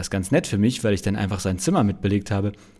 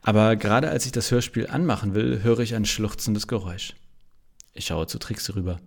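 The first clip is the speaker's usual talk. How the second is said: plain reading voice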